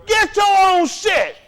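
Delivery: slow, high, loud shout. Real, not acted. Lecturing students, shouting the point home. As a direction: yell it at the room.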